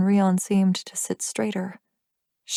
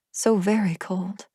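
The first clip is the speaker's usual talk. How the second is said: usual speaking voice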